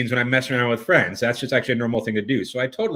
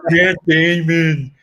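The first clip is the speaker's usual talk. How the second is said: very slow, higher dopey name-saying; Team America puppet; 4 semitones higher, 4 dB louder, much slower than usual. Acted. Caller showing what the bad impression recalls, slow puppet voice. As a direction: drag both words out in a mock puppet voice. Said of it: "I just think of Team America World Police".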